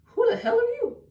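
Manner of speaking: high-pitched affronted question; incredulous. Retold or imitated. Spotting a random girl on boyfriend's follower list, incredulous. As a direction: shoot up high, as if confronting a stranger.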